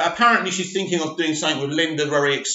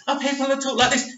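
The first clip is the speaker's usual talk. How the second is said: high, fast, camp voice, a little softer; camp voice demo; 7 semitones higher, 3 dB quieter, faster than usual. Shown as a demonstration. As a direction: flick into a higher, quick camp voice.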